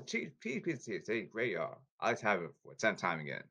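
normal talking voice

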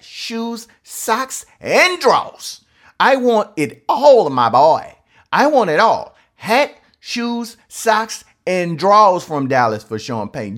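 loud, high, demanding rant building to yells; shouting rant. Real, not acted. Saints fan ranting at Dallas fans over Sean Payton, loud and high. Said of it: "Let me get in my rant now".

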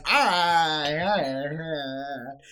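strained open-mouth ahs, fading; mouth-full mumble. Retold or imitated. Patient groaning at the dentist, fading ahs. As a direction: groan through a dentist's hands. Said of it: "I'm like".

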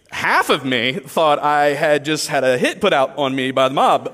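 ordinary delivery

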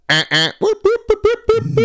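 loud, very high siren whoops; imitated police siren. Police pulling up as he grabs her arm, whooped siren. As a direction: whoop like a squad car, piercing and quick.

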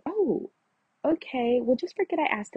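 high, startled "oh, okay" then backing off; mock surprise. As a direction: act taken aback and drop it. Brushed off after asking roommate.

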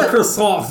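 "croissant" in loud, high mock French; hammed-up French "croissant" amid laughter; exaggerated French pronunciation. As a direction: belt "croissant" with a showy nasal French ending, no t. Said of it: "go out of her way to pronounce it like".

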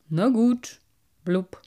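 mocking old carp with burbling blubs; a know-it-all fish looking down on the collection. Carp speaks.

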